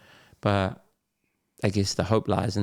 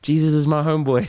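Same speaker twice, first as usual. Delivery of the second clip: mock mum quote; 6 semitones higher, 4 dB louder, faster than usual. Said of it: "she's like".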